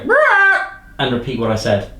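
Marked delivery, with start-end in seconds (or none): 0.0-0.7 high shrill parrot squawk. Shown as a demonstration. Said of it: "urge to go".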